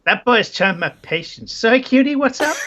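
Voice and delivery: put-on female accent, lifting high; Isabella. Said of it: "this is the best accent ever".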